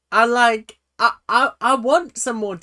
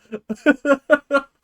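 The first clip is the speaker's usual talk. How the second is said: high, loud burst of laughter; delirious laugh; 9 semitones higher, 6 dB louder than usual. Real, not acted. Reviewer amused by his own goofy laugh, real cackling. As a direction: crack up at yourself.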